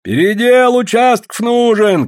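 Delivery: high, loud drawn-out call; shout from the crowd. A narrator's reading. Someone in the crowd demanding redivision, loud shout. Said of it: "крикнули из толпы".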